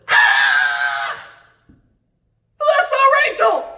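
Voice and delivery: high, loud mock shriek of horror; hammed-up shout of dismay; shocked shout. Jacob waking beside the wrong bride, a shocked shout. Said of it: "Looks over and goes".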